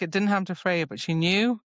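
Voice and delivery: ordinary talk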